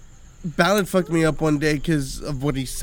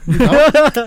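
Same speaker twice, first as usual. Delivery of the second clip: called out louder and higher; 6 semitones higher, 8 dB louder than usual. Real, not acted. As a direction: shout the question across.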